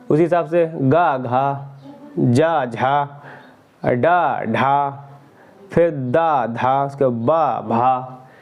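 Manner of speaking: very slow pairs, गा light then घा with a strong puff; slow aspiration drill. Shown as a demonstration. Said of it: "अल्प प्राण में कम जोर देना". (बहुत धीरे-धीरे, पहला हल्का, दूसरा जोर से)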